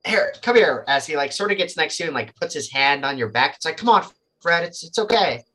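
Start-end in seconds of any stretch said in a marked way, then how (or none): 0.0-0.8 higher-pitched coaxing; urging. Acted. Jesse beckons the butler to him, urging. 3.8-5.4 higher, gently urging; coaxing reassurance. Acted. Jesse with a hand on the butler's back, coaxing.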